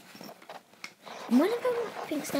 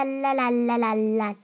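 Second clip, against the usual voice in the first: usual speaking voice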